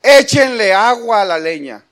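loud, bold order to the people; bold command. Retold or imitated. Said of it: "fíjense qué atrevido".

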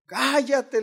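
loud, high hushing of someone; imitated shouting. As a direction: shout them down, stretching the word. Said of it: "con gritos".

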